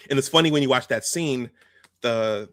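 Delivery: normal talking voice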